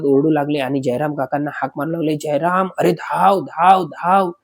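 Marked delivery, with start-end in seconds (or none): 2.1-4.3 urgent call across the dark, pitch rising; calling out for help. Retold or imitated. Friends up in the tree calling Jayram, urgent call. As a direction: shout for Jayram to come running.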